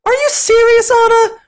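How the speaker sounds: very high squeaky falsetto; squeaking. Acted. Elsa squeaking at Anna in disbelief. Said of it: "her sister squeaked".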